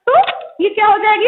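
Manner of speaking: ordinary talk, nothing marked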